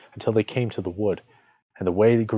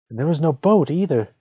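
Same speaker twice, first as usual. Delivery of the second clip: put-on lightly high young girl; a little girl adding to the worry; girl's voice; 7 semitones higher, 5 dB louder than usual. A narrator's reading. Gretel agreeing with her brother, lightly high girl voice. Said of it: "said Gretel".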